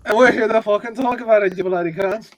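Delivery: hammed-up Butcher, high and quick; Butcher impression. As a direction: rattle it off as a badly imitated Butcher. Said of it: "That's my very bad".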